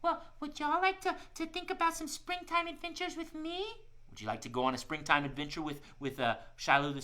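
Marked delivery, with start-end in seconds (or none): 0.0-3.6 much higher, cheerful little squirrel; a friendly shy squirrel talking to kids; squirrel puppet voice. Acted. Squirrel puppet Shiloh tells the kids about winter, high cheerful voice.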